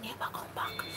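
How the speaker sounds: breathy whispered aside. Real, not acted. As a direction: drop to a secret whisper.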